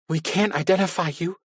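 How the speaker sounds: high, pleading with a catch. A narrator's reading. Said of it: "Carrie's sobbing overtook her".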